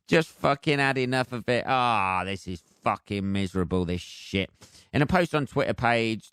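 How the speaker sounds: ordinary talk, nothing marked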